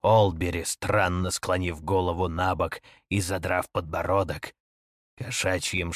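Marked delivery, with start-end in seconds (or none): none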